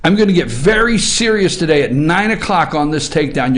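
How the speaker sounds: ordinary delivery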